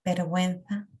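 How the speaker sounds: usual speaking voice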